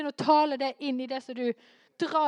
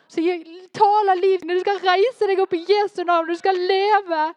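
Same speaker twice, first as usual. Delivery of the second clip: higher and louder, proclaim life like a preacher; preaching at full force; 6 semitones higher, 7 dB louder than usual. Acted. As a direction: command it in Jesus' name. Preaching, speaking life in Jesus' name. (forkynnende, høyere og sterkere)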